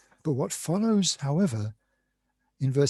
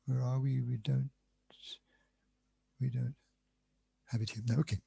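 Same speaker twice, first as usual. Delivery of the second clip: muttered softly to himself while searching; quiet aside; 2 semitones lower, 9 dB quieter, faster than usual. Real, not acted. Lecturer searching his notes, muttering quietly. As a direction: drop the voice, talking to yourself.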